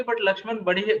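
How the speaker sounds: ordinary talk, nothing marked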